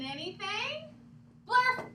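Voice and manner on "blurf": loud high nonsense yell; silly shout. Acted. Teacher reading to class, loud silly shout. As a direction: bark out the funny word.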